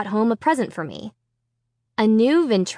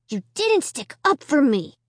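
grumbling; 6 semitones higher than usual. Acted. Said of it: "he grumbled".

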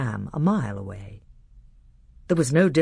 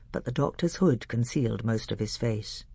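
ordinary delivery, nothing marked

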